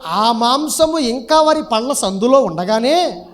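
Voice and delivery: slow, measured scripture recital; preacher's drawn-out verse reading. Acted. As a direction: read the verse out slowly to a congregation. Reads scripture aloud.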